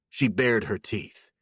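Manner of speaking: plain reading voice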